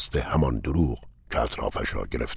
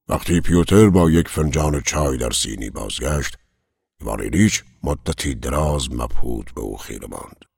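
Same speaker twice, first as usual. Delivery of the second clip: usual speaking voice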